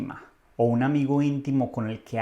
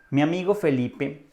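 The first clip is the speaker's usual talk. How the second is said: normal talking voice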